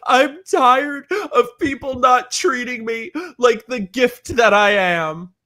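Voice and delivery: high, slow, whiny mock sob; mock sobbing diva. Quoting a sobbing star to the camera, mock wail. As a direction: ham up a self-pitying celebrity. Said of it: "she's sobbing to the camera".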